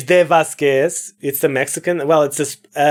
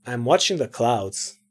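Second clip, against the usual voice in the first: low, soft, dismissive delivery; cool, low-pitched brush-off; aloof character; 7 semitones lower, 7 dB quieter than usual. Acted. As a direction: say it low and uninterested. First take as aloof character.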